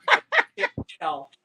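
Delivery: telling the husband, cracking up at it; laughing. Real, not acted. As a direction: say it through laughter. Laughing while retelling her reply.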